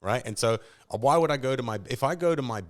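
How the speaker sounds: ordinary talk, nothing marked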